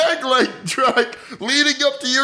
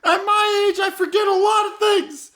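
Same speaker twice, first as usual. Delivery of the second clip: put-on higher old woman's voice, cracking a little with a laugh; old lady voice; 7 semitones higher than usual. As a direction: play the forgetful old grandma, pitched up. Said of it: "It's me, Miss Butter".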